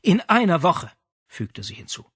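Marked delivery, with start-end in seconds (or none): 0.0-0.9 outraged mother. Acted.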